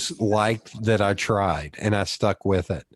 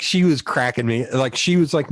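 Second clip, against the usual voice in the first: usual speaking voice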